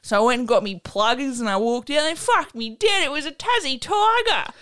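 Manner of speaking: put-on broad Aussie accent. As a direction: read it as an excited Aussie yarn, peaking in a high yell. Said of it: "I'm gonna read it in the Aussie accent".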